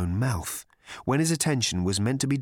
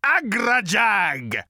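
louder, higher, slow raging roar; 12 semitones higher, 5 dB louder, much slower than usual. A narrator's reading. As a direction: roar with wounded pride. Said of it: "roared the voice".

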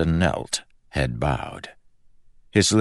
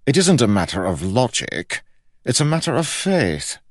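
high, indignant insistence; raised, cross royal voice; scowling king; 9 semitones higher, 4 dB louder than usual. A narrator's reading. George scowled.

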